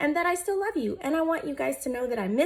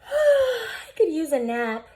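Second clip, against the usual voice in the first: sleepy; 6 semitones higher, 4 dB louder, slower than usual. Acted. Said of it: "Amos yawned".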